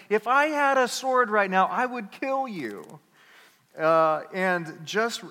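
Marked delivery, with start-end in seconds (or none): 0.1-3.0 mock threat. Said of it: "And he's like".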